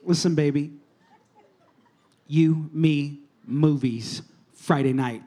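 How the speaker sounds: lightly played would-be ladies' man, low and halting; mock smooth pickup.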